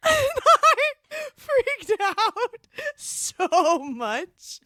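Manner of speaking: high, drawn-out laughing squeal; giddy squeal. Real, not acted. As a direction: gush helplessly through laughter. Gushing over the line, laughing.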